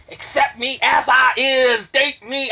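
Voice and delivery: loud, drawn-out mock begging; put-on needy holler; mock desperate plea. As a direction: belt it out slowly, hammed-up.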